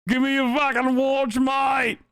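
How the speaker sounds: high-pitched, hammed-up Aussie demand; exaggerated Aussie "mate" voice, high; attempted Australian accent. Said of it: "how to do an Aussie accent".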